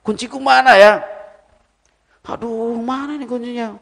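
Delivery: high, mock-puzzled search for the keys; pretending not to know. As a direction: play innocent, asking where the keys went. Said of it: "terus pura-pura nggak tahu". (pura-pura bingung mencari kunci)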